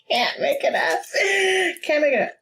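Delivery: high, laughing disbelief; high, gleeful exclamation; delighted shout. Real, not acted. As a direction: call it out in glee. Cards match, delighted.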